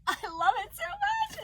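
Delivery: high, loud burst of delight; too excited. Real, not acted. Crocheter loving her gift, overexcited gush. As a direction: gush it, carried away. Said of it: "I got too excited".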